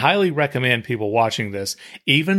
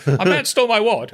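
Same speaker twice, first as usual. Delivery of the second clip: Monty Python sketch voice; 8 semitones higher than usual. Retold or imitated. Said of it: "thinking about Monty Python".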